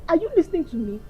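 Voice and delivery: ordinary delivery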